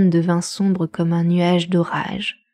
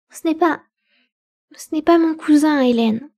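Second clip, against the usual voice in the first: distressed; 7 semitones higher than usual. A narrator's reading. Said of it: "avec un chagrin accru".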